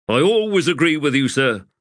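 lightly voiced insolent soldier; Pors, cheeky. Pors sassing his commander, lightly voiced and cheeky.

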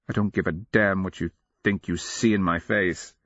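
plain reading voice

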